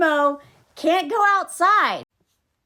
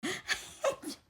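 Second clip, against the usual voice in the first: higher, put-on voice, whispered "ready"; sing-song photo voice; 8 semitones higher than usual. Mom about to snap Gizmo's picture, cooing then whispering. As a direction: coo it like a parent posing a shot.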